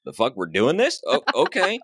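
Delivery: mock disbelief rising high on okay; stunned surprise. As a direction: play the pacer caught off guard. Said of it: "he goes".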